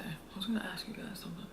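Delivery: soft unvoiced whisper; a hushed aside. Real, not acted. Quiet aside to viewers.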